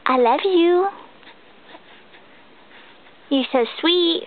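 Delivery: normal talking voice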